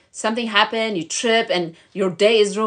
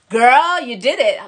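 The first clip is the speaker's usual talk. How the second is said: impressed cheer; 5 semitones higher, 7 dB louder than usual. Acted. Voices amazed friends cheering her.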